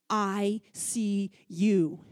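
slow, hushed, tender; gentle divine voice. Retold or imitated. God speaking to the weeping mother, slow and tender. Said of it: "And all I heard was".

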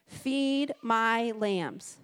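drawn out, each word set down; slow, weighty command. Acted. Jesus to Peter on the shore, slow and weighty. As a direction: let the words land heavily.

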